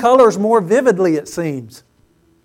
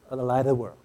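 hushed after the build; 8 semitones lower, 11 dB quieter, much faster than usual. Shown as a demonstration. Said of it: "No one else".

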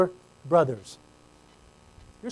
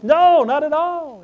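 higher, louder, slow insistent denial; Peter's denial; 8 semitones higher, 6 dB louder, slower than usual. Acted. Peter, questioned by the girl, denies loudly and slowly. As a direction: deny it hard and drawn out.

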